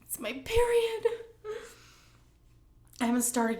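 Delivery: high, loud tearful squeak; emotional. Real, not acted. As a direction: voice shooting up into a cry. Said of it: "I'm starting to get emotional again".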